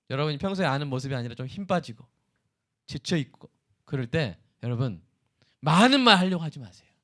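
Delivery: ordinary delivery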